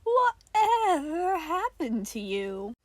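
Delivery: high, slow, whimpering sing-song; uncanny mock concern. Nicola mock-pities Johnny's changed form, high and slow. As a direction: pretend to pity him.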